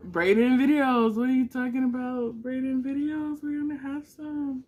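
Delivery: loud, high exclaim; excited shout. Real, not acted. Promising fans new videos, loud and thrilled. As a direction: shout it out in joy.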